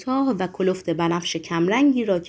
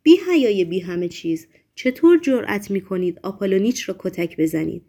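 ordinary delivery, nothing marked

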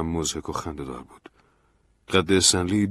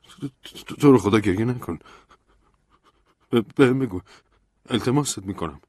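pleading; 4 semitones higher, 5 dB louder than usual. A narrator's reading. Begging Stanley not to cry.

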